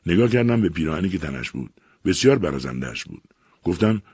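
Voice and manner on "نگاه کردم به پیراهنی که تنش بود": ordinary delivery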